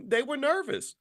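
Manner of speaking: ordinary delivery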